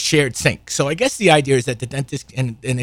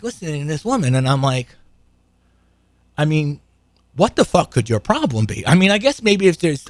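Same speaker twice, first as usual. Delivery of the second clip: ordinary talk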